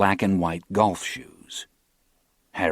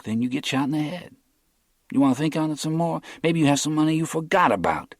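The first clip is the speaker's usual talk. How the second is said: higher, fast taunting threat; mocking menace; 9 semitones higher, faster than usual. A narrator's reading. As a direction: needle him with the threat. Captor menacing the broke captive.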